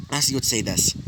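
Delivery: usual speaking voice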